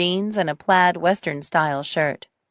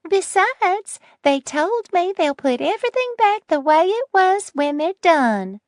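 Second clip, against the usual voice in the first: put-on sweet old lady voice; 13 semitones higher than usual. Nettie voiced in story.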